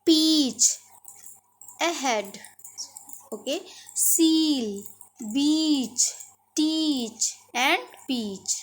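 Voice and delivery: slow, careful पीच, सील, टीच with long ee; model words. Shown as a demonstration. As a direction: spell out each ee word slowly. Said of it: "pronunciation को ध्यान से सुनिएगा".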